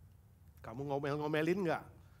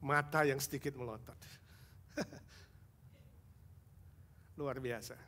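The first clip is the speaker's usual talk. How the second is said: usual speaking voice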